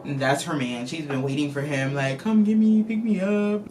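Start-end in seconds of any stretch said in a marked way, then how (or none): 2.2-3.7 mock pleading. Reviewer imitates Kat calling for her man, mock pleading. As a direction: plead like a waiting girlfriend, playfully put on.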